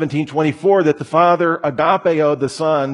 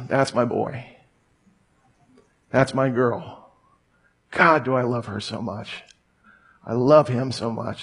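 tender father; 2 semitones lower, 5 dB quieter than usual. Acted. God thinking fondly of his child, softly. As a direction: murmur it like a loving father. God's tender thoughts about you.

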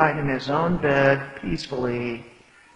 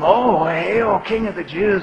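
sneering mock hail, pitched up; mocking salute; 6 semitones higher, 6 dB louder than usual. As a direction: taunt him like a jeering soldier. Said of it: "taunt him".

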